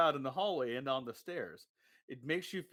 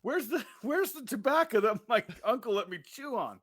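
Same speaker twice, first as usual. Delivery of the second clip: put-on country kid voice; 7 semitones higher, 4 dB louder than usual. Voicing the farm kid.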